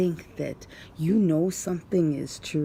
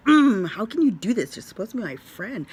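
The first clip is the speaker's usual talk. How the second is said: indignant retold outburst, pitched up; mad; 5 semitones higher, 7 dB louder, faster than usual. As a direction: retell it as a mad protest to a friend. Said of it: "I was really mad".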